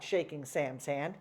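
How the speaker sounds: ordinary delivery, nothing marked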